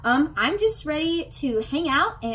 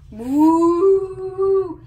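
loud, high whoop; excited whoop; 6 semitones higher, 8 dB louder than usual. Acted. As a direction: let out a cheer.

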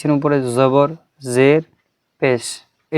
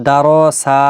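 দা র সা with a long stretched সা; wrongly drawn out; 4 dB louder than usual. Shown as a demonstration. Teacher shows the forbidden stretch, drawn-out syllables. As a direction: drag out the ending of দা র সা. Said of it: "টান দেওয়া একদমই যাবে না".

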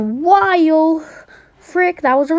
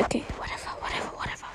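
whispered, giving up; resigned whisper; 18 dB quieter, much faster than usual. Real, not acted. Player gives up after sputtering, whispering it away. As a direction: drop to a tiny dismissive whisper.

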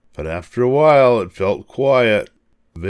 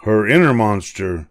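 ordinary delivery, nothing marked

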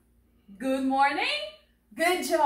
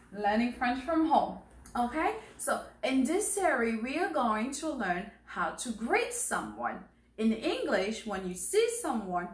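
ordinary delivery

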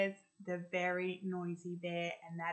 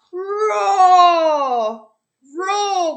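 big, loud, high roaring growl; bear roar; 12 semitones higher, 20 dB louder than usual. Acted. The noisy bear grabbing the microphone, loud roar. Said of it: "went the noisy bear".

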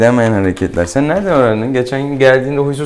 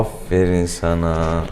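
slow, lower, drawn-out pet talk; tender praise; 4 semitones lower, slower than usual. Real, not acted. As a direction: croon it slowly to a calming cat. Vet praising the eating cat. (yavaş, şefkatli övgü)

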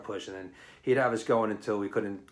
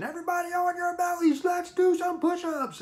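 much higher, barked mock command; drill instructor impersonation; much faster than usual. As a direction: ham up a drill sergeant. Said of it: "in his best drill instructor impersonation".

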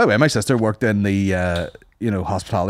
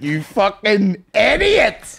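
slow mock insult; 10 semitones higher, much slower than usual.

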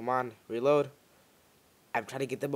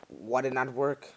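normal talking voice

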